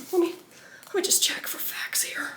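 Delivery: very soft, barely voiced mutter; whispered aside. Real, not acted. Reader checking the book for the page, whispered aside. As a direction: whisper it to yourself.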